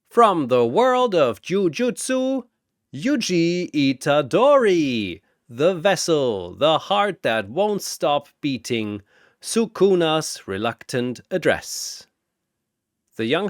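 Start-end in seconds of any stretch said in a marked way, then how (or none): 0.1-12.1 booming announcer. Acted. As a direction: boom it across the arena. Announcer introduces next fighter.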